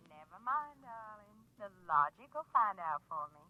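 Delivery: low, hushed seductive purr; sultry flirt. Acted. Lorene luring Frank to come over, low seductive purr. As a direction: lure him with a low, lonesome coo.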